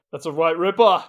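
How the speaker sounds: loud Aussie slang imitation; Australian accent. Quoting an Australian housemate's saying, loud Australian accent. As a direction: punch it out in Australian. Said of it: "the slang sayings that he has".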